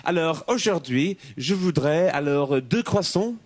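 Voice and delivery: put-on lightly French-accented bakery order, slowing at the baguette; mock French order. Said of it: "Do they go into the boulangerie?".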